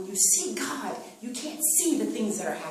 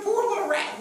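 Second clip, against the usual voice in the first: high, pitying coo; mock pity; 11 semitones higher, 5 dB louder than usual. Congregation's imagined pity for the rat, cooed high. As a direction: put on a sugary pity for the rat. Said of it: "You're like".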